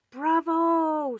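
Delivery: high, loud cheering bravo; shout. Acted. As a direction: cheer the magician loudly. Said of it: "shouted Mouse".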